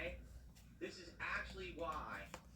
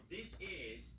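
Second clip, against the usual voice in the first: whispering; 11 dB quieter than usual. Real, not acted. Streamer whispering intro.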